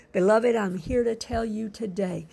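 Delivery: normal talking voice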